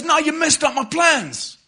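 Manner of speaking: slow, high, loud yell; acted girl shouting over noise; shouted accusation. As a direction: holler each word over the crowd.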